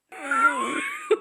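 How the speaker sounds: a very high gleeful squeal; high squeal laugh. Real, not acted. Swooning over the couple, high squealing laugh. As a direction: squeal with glee. Amused after reading.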